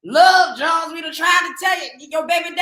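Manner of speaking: high, loud mock call-out; hammed-up shout at the viewer; mock yell. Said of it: "have me up here talking about".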